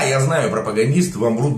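usual speaking voice

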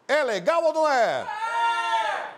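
high, loud game-show cheer; hammed-up hype. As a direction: whip up the crowd. Hyped audience answer.

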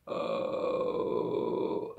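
low creaky rasp with no voice; vocal fry grunt. Shown as a demonstration. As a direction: make a croaky frog-like rasp. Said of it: "tipo um ronco". (ronco grave de vocal fry)

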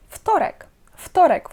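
"Вторек" begun with an f sound; devoiced first sound. Shown as a demonstration. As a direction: say "Вторек" as "ftorek". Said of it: "воно оглушується".

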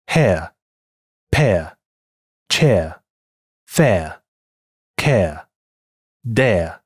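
British hair, pear, chair without the r; crisp British air, no curl at the end; British English. Shown as a demonstration. Teacher reads the words in British English. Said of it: "英音".

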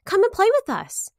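loud, high invitation to play; high cheerful call. A narrator's reading. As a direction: call the friend over, happy. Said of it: "they called".

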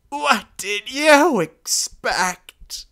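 very high, slow, loud, falling at the end; the old deer echoes the captain, bitter and loud; bitter old pronghorn. Acted. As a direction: throw the words back at him, slowly. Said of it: "spoke in the voice of a glacier".

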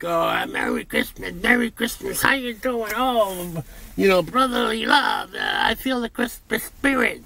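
high, sing-song mock of phony holiday cheer; mocking fake cheer. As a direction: ham up a fake-friendly Christmas greeter. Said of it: "they're like".

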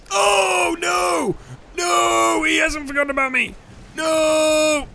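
high frantic yells to go away; terrified shouting while being chased; panicked screaming. Real, not acted. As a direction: scream at the raptor to leave you alone. Raptor chasing him.

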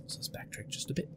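hushed whisper. Real, not acted. Player muttering a plan to self, whispered. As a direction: breathe it to yourself.

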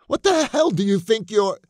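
loud, high-pitched alarmed shout. Acted. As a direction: yell it at him, cut off. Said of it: "she shouted".

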